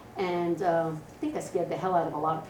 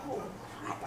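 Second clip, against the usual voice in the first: whisper; 13 dB quieter than usual. Retold or imitated. Caught eating in bathroom stall.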